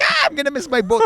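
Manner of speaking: high, loud panicked yelp; panicked cry. Retold or imitated. Traveler running for the boat, acted panic. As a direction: cry out in comic panic, pitch way up.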